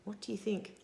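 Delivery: ordinary delivery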